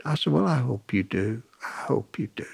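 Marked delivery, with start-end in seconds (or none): 1.6-2.5 a soft unvoiced echo; whispered. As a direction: drop it to a whisper the second time. Repeats his wish to the man.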